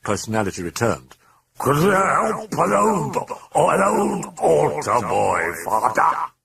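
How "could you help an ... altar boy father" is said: high, loud, slow croak; demonic croak. A narrator's reading. Demon mocking the priest for help, loud croak. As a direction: croak it out, dragging the words. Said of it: "it croaked".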